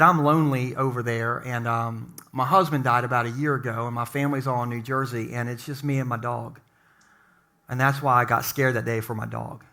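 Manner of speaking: low, soft, lonely confession; downcast, hushed retelling; retold sadness. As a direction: quote a grieving neighbour, subdued and quiet. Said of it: "I'm just really sad".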